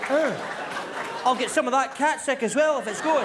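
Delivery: apologetic dog offering to clean up; eager dog. Pretend. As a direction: grovel like a too-keen dog.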